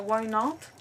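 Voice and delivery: usual speaking voice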